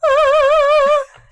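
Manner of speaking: a loud, piercing mock scream; imitating a scream. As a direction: belt out a superhero scream. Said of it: "she screams really loud and goes".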